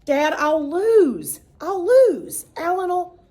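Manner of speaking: put-on high, whining pleas from a child; pleading boy. A narrator's reading. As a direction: beg dad not to make him lose. Boy begging his father.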